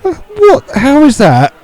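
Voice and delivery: very high, disbelieving question; incredulous protest. Real, not acted. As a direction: demand an explanation in real disbelief. Still disputing the referee's call.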